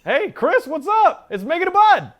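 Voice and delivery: high, loud, thrilled hello to friends; excited greeting. Real, not acted. Host happily greets co-hosts arriving on set, high and loud. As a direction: greet them like you're overjoyed.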